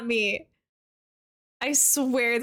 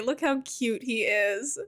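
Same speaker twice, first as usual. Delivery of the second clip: usual speaking voice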